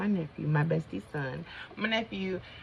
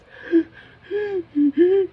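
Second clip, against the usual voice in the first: loud, high gasps for air; reenacted gasping; 14 semitones higher, 11 dB louder than usual. Showing how she smothered herself with a tissue, loud mock gasps. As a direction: imitate gasping against a blocked nose. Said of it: "I'm holding the tissue like this".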